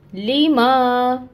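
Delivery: लीमा very slow, मा held long; two-beat madd. Shown as a demonstration. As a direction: stretch the मा of लीमा. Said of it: "इसको पढ़ेंगे लीमा".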